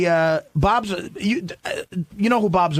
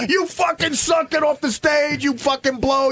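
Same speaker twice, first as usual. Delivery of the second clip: screaming heckle; 11 semitones higher than usual. Retold or imitated. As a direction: bark it at him like the worst heckler. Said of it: "I just scream at him".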